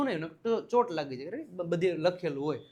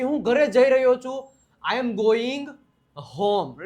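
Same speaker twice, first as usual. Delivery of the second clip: higher and louder, showing the sentence to the class; emphatic model sentence; 5 semitones higher, 7 dB louder, slower than usual. Shown as a demonstration. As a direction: call it out clearly for students to copy. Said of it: "એવું બોલી શકો". (જોરથી, ઊંચા અવાજે બોલો)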